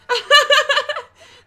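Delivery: high, loud brittle laugh; forced laugh. Acted. Edena laughing off Kei's accusation, forced high laugh.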